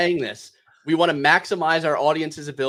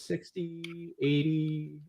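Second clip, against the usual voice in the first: usual speaking voice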